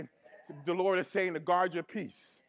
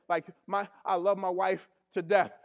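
normal talking voice

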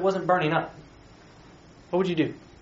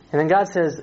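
ordinary talk

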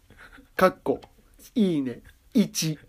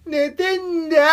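angry yell; 12 semitones higher, 5 dB louder, slower than usual. Retold or imitated. Retold yell at loudspeaker.

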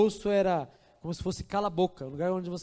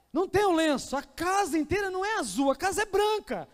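rising to an ecstatic shout, much higher and louder; excited shouting; 10 semitones higher, 11 dB louder than usual. Acted. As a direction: she bursts out with the news, then yells the father's cry. Excited climax, father screaming. (mulher grita eufórica ao ver o pai)

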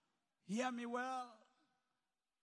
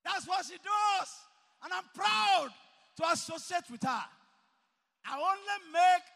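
preaching at full force; 9 semitones higher, 14 dB louder than usual. Acted. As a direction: hammer each phrase out to the hall. Preacher shouting pride in mother.

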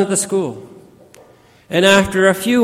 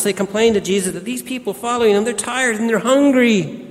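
ordinary delivery

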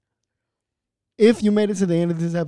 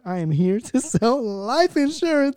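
imitating the woman; 7 semitones higher, slower than usual. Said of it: "This woman said".

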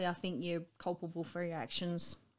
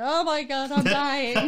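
much louder, higher hammed-up agony; mock dying moan; 7 semitones higher, 11 dB louder, slower than usual. Patient after transfusion to listener, hammed-up loud moan. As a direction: ham up feeling awful. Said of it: "two days later".

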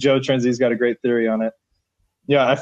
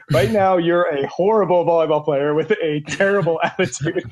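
quoting the coach while cracking up, voice higher; laughing through the quote; 6 semitones higher than usual. Retold or imitated. Recalling the coach scolding a lazy player, laughing. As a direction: giggle through the insult you're retelling.

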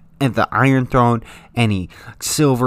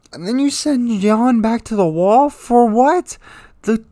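much higher and louder, incredulous; exasperated outburst; 9 semitones higher, 4 dB louder than usual. Real, not acted. Outraged at sending Jon back to the wall, yelling. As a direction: throw up your hands and yell it.

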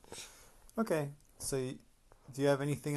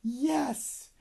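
high cheerful yes; 12 semitones higher than usual. Acted. Party crowd answers bulldozer.

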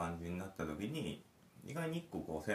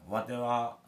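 playful Kansai-ben game line, a bit louder; mock Kansai dialect; 4 semitones higher, 5 dB louder, much slower than usual. Dad playing the bedtime game with his kid, mock Kansai. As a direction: ham up the Kansai 'wate' like a storybook merchant. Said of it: "関西弁で言うのがポイント".

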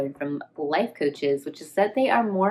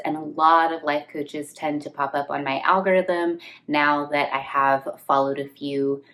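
ordinary talk

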